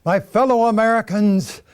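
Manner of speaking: loud, formal statesman address; elder statesman. Acted. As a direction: proclaim it like a secretary of state. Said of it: "the Honorable William Henry Seward".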